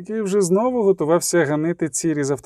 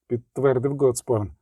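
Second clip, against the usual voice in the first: ordinary delivery, nothing marked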